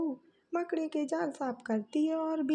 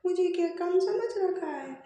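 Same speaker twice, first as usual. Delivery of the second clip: complaining daughter-in-law; 3 semitones higher, 4 dB louder than usual. Acted. Eldest bahu protests to her mother-in-law, loud and aggrieved.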